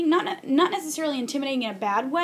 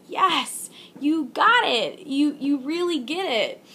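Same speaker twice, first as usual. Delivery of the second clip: delighted praise; 4 semitones higher, 3 dB louder, slower than usual. Retold or imitated. Voicing her delight to nephew.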